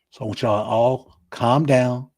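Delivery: ordinary talk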